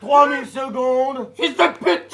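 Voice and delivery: slow then a blurted swear; loud exasperated outburst. Acted. Patient bursts out at the wait, loud swearing tic. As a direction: explode with frustration.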